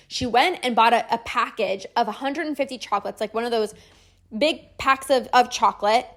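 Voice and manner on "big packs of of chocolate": ordinary talk, nothing marked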